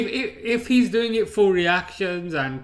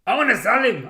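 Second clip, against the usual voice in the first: put-on Irish accent; 8 semitones higher, 8 dB louder than usual. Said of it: "I can't do an Irish accent".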